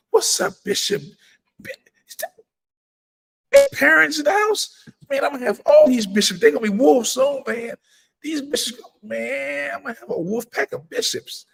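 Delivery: light up as friends join the stream; excited greeting. Real, not acted. Host greeting bishops who join his livestream, excited and high.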